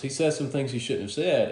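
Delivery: usual speaking voice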